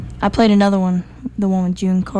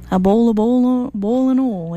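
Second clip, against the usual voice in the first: put-on Southern accent, "oil" said as "ol"; Southern accent showing a friend how it's said; Southern drawl; 3 semitones higher than usual. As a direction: say "boiling oil" the way folks say it down here. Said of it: "That's not how I said it".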